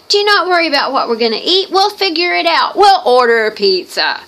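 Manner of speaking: Mary greeting guests, carefree and bubbly; breezy welcoming hostess. Acted. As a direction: throw the door open for guests with a carefree lilt. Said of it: "Mary, who is Martha's sister, is like".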